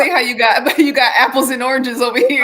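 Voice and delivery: high, loud laughing through the words; excited laughing. Real, not acted. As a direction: laugh while pressing the point.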